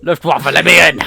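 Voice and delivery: hammed-up shouted order; mock door shout. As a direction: shout through the door like a raiding soldier. Acted Luftwaffe banging at the door.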